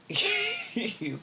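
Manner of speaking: high laugh. Real, not acted. Laughing at own remark.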